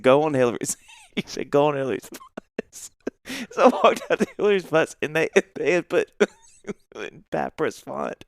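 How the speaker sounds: real laughter breaking up the words; laughing while talking. Real, not acted. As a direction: tell it through helpless giggles. Laughing while telling the prank.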